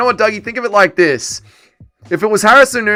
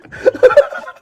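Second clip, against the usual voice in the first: silent wheezy laughter; laughing till it hurts; wheezing laugh. Real, not acted.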